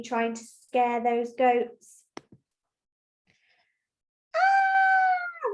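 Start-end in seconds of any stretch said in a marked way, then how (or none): 4.3-5.5 high-pitched acted falling cry; falling scream. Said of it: "he's shouting because he's fallen".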